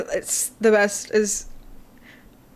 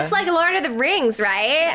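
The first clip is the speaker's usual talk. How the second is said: high, put-on American accent; imitated American accent; 6 semitones higher, slower than usual. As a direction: gush like an American tourist. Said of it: "That's a really good accent".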